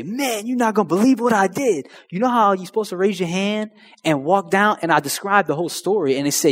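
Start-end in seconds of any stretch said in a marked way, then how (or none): 0.0-4.8 higher, eager retelling; bursting to tell a friend; calling friends, buzzing with news; excited news. Retold or imitated. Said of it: "I'd call them and say".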